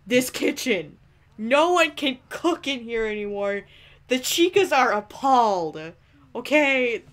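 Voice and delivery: loud exasperated telling-off, slowed for effect; mock scolding. Real, not acted. Player scolding Freddy for ruining kitchen, mock anger. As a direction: scold him like a messy roommate.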